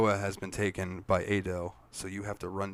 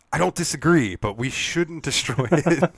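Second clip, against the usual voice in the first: higher and louder mock Squall, slowed; 6 semitones higher, 8 dB louder than usual. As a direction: play Squall grudgingly agreeing, loud and high. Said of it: "Squall's like".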